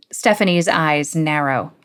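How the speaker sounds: plain reading voice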